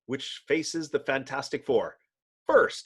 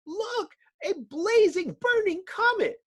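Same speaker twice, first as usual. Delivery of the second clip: astonished cry. Acted. Bystander sees the flaming torch.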